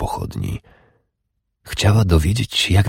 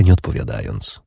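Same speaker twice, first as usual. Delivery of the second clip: plain reading voice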